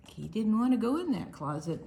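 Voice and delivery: ordinary delivery, nothing marked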